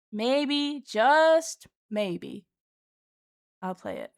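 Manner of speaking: playful drawn-out maybe; teasing. Real, not acted. Teasing viewers about playing.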